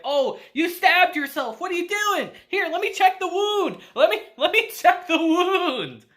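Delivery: high, loud, panicky fussing over a wound; squeaky worried idiot hero; frantic dimwit. Retold or imitated. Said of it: "Uncle, being a total moron, of course, is like".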